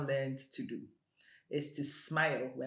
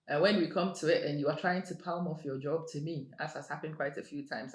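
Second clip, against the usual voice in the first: ordinary delivery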